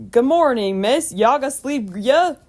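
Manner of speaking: put-on high, loud country-accented greeting; old farmer's country accent. A narrator's reading. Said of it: "asked the man".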